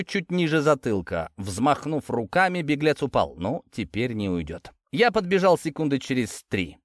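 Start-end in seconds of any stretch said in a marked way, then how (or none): none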